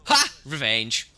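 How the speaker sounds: loud, gloating cry; triumphant outburst. Real, not acted. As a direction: crow over sudden payback. Gamer gets payback kill.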